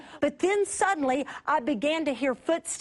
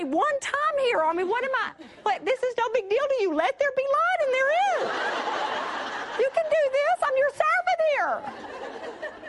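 mock God voice; 9 semitones higher than usual. God answering the preacher in the dark, high and teasing. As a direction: play God as amused and a bit put out.

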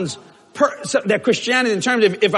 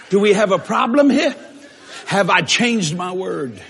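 normal talking voice

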